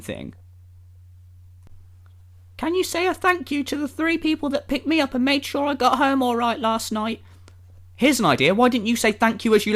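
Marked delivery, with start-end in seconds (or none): 2.6-7.2 high, put-on voice of a poster; mimicking the poster, pitched up; put-on reading voice. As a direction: read the post out in a higher mock voice. Reads out post in put-on voice.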